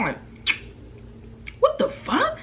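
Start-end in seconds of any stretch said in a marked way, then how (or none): 1.6-2.4 very high, shocked yelp; shocked outburst. Retold or imitated. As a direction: squeal it in sudden disbelief. Hit in the lip.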